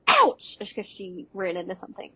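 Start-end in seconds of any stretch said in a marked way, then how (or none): 0.1-0.6 mock ouch. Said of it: "you hear her go".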